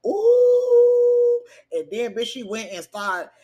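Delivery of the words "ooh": long high held ooh, loud; a loud gossipy ooh of shock; high drawn-out ooh. Real, not acted. Host reacting to the pregnancy news with a loud ooh.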